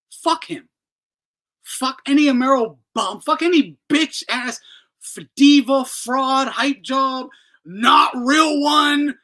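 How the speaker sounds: loud, rising insults; furious rant building to a shout; angry rant. Real, not acted. Ranting insults at Devin Haney.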